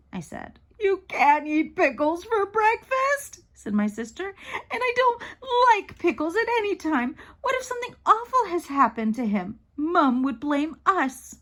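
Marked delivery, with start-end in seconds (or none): 0.8-3.4 put-on high, slow childish protest; stretched-out squeal of objection; outraged kid, pitched way up; indignant little sister. 4.3-11.4 put-on high-pitched childish fretting; wailing complaint from a worried kid; whiny, pitched-up protest; upset little sister. Sister upset, frets about dad.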